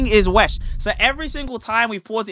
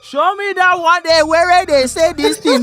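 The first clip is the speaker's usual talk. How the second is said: put-on higher, slow Nigerian accent preacher line; 9 semitones higher, 6 dB louder than usual. Streamer redoing his Nigerian accent bit for cohost. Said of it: "your Nigerian, uh, accent".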